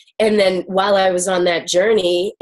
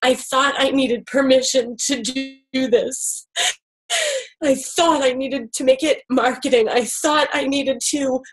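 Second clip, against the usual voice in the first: higher voice, on the edge of tears; tearful; 5 semitones higher than usual. Real, not acted. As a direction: speak through welling tears. Emotional confession after a breakthrough.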